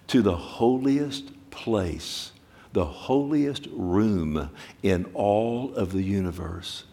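slow awed prayer. Retold or imitated.

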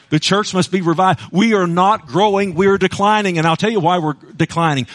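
normal talking voice

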